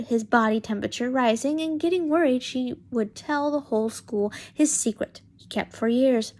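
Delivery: usual speaking voice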